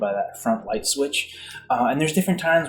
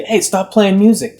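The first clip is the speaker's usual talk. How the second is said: higher, louder shout to the bedroom; calling out; 8 semitones higher, 5 dB louder than usual. Retold or imitated. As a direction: call out to a device in another room. Calling to device in other room.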